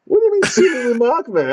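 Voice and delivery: high, fast, laughing outrage; laughing indignation. Real, not acted. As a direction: protest through a laugh. Offended by "silly remark.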